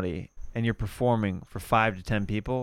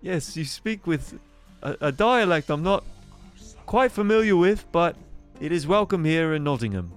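high-pitched English character voice; grand welcome from a high-voiced townsman; Nottingham local; 8 semitones higher than usual. Local welcoming the foreigner to Nottingham, high English character voice.

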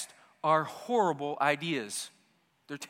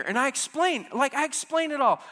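ordinary talk, nothing marked

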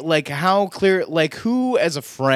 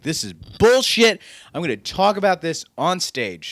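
retold outrage, higher and louder; indignant outburst; 7 semitones higher, 4 dB louder than usual. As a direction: punch it out like a mock protest, slowing on the swear. Acts out his indignant reaction.

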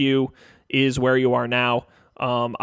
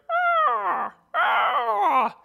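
high bird screech; falcon cry. Acted. Game master voicing an unseen falcon heard overhead, high screech.

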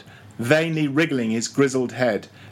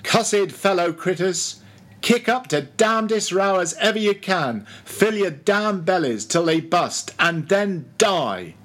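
shrill cry; 6 semitones higher than usual. Acted. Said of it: "raised his shrill voice and cried".

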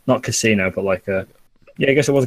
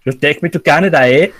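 higher-pitched, badly faked Canadian accent; botched Canadian accent; 3 semitones higher than usual. British podcaster asking friends for Canada, botched Canadian accent. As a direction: try Canadian and miss it. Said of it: "What was that accent?".

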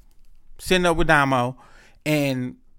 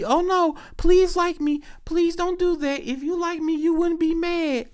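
high, whiny begging voice, way up in pitch; mock pleading girl; 14 semitones higher than usual. Mocking a woman who begs to be liked, high pleading voice. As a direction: mimic her pleading to be liked, hammed up. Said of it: "then you be".

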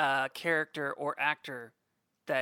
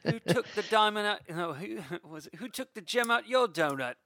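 Snatch quote; 6 semitones higher than usual. Retold or imitated. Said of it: "Snatch quotes".

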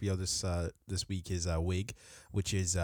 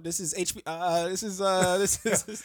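stammering the company name while laughing; a caller botching his cover, cracking up mid-line; fumbling fake caller; 10 semitones higher, 6 dB louder than usual. Fake caller messing up his cover story, laughing. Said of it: "the person messes it up".

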